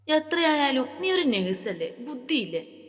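put-on exasperated older sister scolding; sharp scolding. A narrator's reading. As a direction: snap at her to see sense.

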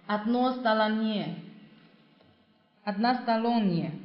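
slow, syllable by syllable односторонние; slow model pronunciation. Shown as a demonstration. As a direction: spell out односторонние slowly for the class. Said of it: "teacher models the word slowly".

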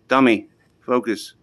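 usual speaking voice

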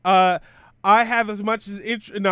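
normal talking voice

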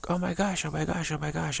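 high, giddy imitation of someone freaking out; mock freaking out. Imitating people overwhelmed by good fortune, giddy oh my gosh. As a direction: ham up their giddy panic. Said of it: "freaking out".

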